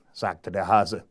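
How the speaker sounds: usual speaking voice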